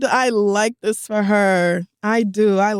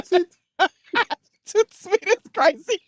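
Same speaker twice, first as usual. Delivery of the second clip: laughing; 9 semitones higher, much slower than usual. Real, not acted. Laughing at own impression.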